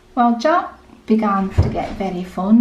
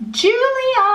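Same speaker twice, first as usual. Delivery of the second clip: calling out; 13 semitones higher than usual. Acted. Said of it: "And he shouted to his".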